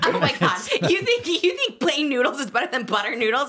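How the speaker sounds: laughing in disbelief, mocking. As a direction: scoff through a laugh at her idea. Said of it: "He's like".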